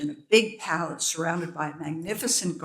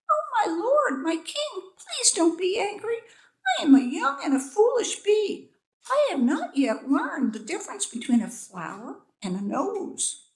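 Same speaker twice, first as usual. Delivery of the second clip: very high, pleading tiny bee voice; 7 semitones higher than usual. A narrator's reading. Young bee begging the king, high pleading. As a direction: beg for mercy in a tiny high voice. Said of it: "it pleaded".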